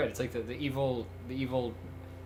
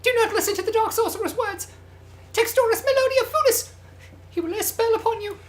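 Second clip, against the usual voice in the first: very high-pitched put-on character voice; frantic warning figure. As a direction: warn of dark magic in a squeaky falsetto. Voices a dark-spell warning character.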